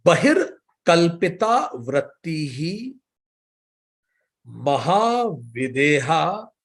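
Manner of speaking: slow, measured chant of each word; sutra recitation. Shown as a demonstration. A teacher recites the yoga sutra to students, slow and measured. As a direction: recite the verse word by word, slowly.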